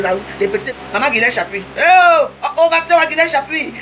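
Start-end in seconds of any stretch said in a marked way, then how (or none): 1.8-3.7 loud, high, drawn-out hail; calling out. Real, not acted. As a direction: call her name across the room, slowly. Calling out to Guylaine on live. (interpelle Guylaine en direct, appel fort et traînant)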